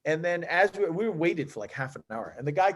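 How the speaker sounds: usual speaking voice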